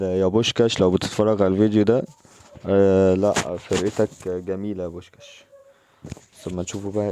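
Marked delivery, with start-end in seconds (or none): 0.0-5.4 loud call to a viewer; loud friendly shout-out. Real, not acted. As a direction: shout out to Bushkash watching. Calling out to Bushkash.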